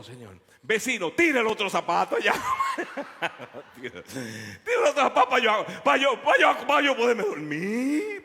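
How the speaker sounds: loud, high pleading through laughter; desperate plea. Retold or imitated. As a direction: beg him to throw the shoe, half laughing.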